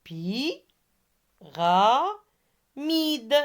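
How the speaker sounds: PI, RA, MIDE with long gaps between; syllable by syllable. Shown as a demonstration. Teacher repeats for pupils hunting the sound I, syllables separated. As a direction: isolate each syllable of "Pi, ra, mide". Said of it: "première syllabe et troisième syllabe".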